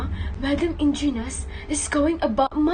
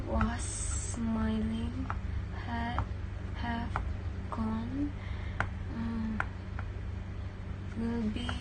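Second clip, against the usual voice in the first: much softer, low murmur while copying; murmured to herself; 5 semitones lower, 12 dB quieter, slower than usual. Acted. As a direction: read the answers half to yourself. Copying answers under her breath.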